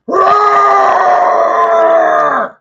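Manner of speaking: huge sustained roar, high and loud; roaring on request for the audience. Acted. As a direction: let out a long beast roar for the fans. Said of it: "a request to do a roar".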